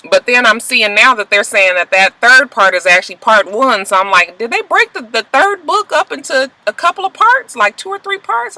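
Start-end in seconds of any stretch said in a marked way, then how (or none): 4.4-8.6 much higher, incredulous questioning; mock disbelief. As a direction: voice your disbelief way up high. Voicing own reaction to movie split.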